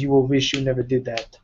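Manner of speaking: put-on a light Russian accent, quoting the game character Arvo; Arvo's Russian accent. Gamer quoting the Russian character, mild Russian accent. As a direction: slips into a Russian accent to quote him. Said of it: "hard for me to quote him without saying that in his accent".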